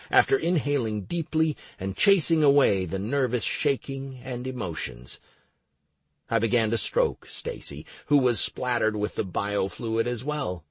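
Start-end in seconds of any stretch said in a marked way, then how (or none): none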